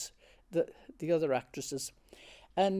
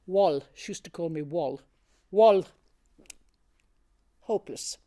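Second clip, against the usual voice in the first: retold curt dismissal; 6 dB louder than usual. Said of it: "she stopped it and she said".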